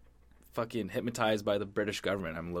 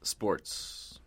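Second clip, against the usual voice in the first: whispered; mock-dramatic whisper; 2 semitones lower, 6 dB quieter than usual. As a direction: breathe the word out in a whisper. Whispered topic intro.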